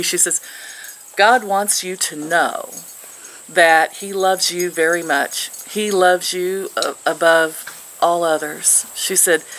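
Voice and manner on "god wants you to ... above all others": slow, deliberate retelling of a prophecy; retold divine message. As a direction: relay it slowly, word by word. Retelling a stranger's message from God.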